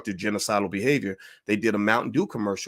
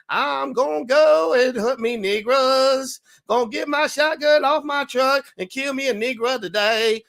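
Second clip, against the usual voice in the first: mocking country singer; 12 semitones higher, 5 dB louder, slower than usual. Mocking a country singer, high and loud. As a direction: belt it out like a mock hillbilly singer. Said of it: "If he's like".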